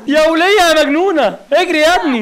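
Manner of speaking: loud, high frantic cries; panicked yelling. Real, not acted. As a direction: yell in panic at the screen. Panicked yelling at game character. (صراخ مذعور)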